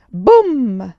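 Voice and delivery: a booming blast, shouted out; loud boom. A narrator's reading. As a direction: burst out with the cannon's boom. Narrator voices the explosion.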